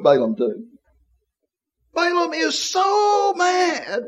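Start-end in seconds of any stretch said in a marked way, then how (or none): 1.9-4.0 high, slow exclaimed outrage; mock outrage. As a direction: play up his fury with a raised voice. Preacher acting out rage.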